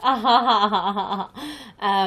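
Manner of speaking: loud toothy horse-like laugh; bare your teeth and laugh like a horse. Retold or imitated. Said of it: "laugh like this".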